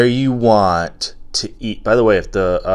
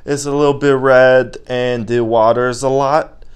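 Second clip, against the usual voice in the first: put-on young boy; 3 semitones higher, 4 dB louder than usual. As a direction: play a kid reporting his ailment. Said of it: "And he's like".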